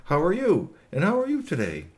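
a hint of a friendly visiting alien; lightly voiced alien.

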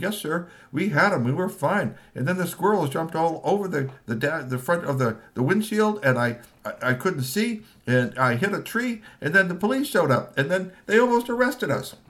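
nervous excuses. Acted. Tony explaining the crash to the boss, high nervous rush. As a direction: stammer out the story to the boss. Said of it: "Tony said".

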